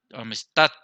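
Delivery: "amistad" leaning on the final "tad"; "amistad", last syllable stronger and rising; stress on last syllable. Shown as a demonstration. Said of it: "tenemos la mayor fuerza".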